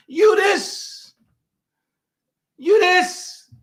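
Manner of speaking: high sneering echo; mock echo. Vlogger mocks the leader in a high sneering voice. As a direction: repeat it high and scornful. Mocking repeat.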